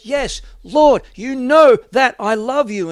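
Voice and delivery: upset, high and loud protest; distressed outcry of Peter to Jesus. Acted. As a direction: Peter hurt, insisting loudly. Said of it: "Peter's getting upset now".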